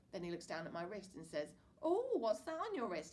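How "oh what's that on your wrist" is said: higher, surprised dad; sudden curious spot; Dad noticing. A narrator's reading. Dad to son, surprised question.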